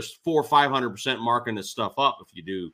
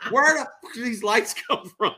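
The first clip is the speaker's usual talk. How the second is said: a much higher, angry outburst in the builder's voice; irate builder; 12 semitones higher than usual. Retold or imitated. Builder finds lights he never installed, angry yell. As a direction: imitate the builder blowing up over extra lights. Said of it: "the builder was irate".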